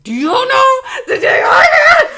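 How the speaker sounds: very high, loud shouted comeback; indignant shriek. Retold or imitated. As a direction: scream it back, incredulous, near laughter. Shouted retort to same question.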